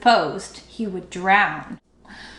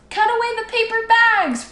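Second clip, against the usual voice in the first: high, loud order from the bank; owner roaring; 13 semitones higher, 3 dB louder than usual. A narrator's reading. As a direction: bellow the command across the water. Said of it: "roared the owner".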